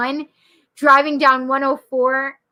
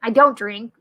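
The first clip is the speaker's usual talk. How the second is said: ordinary delivery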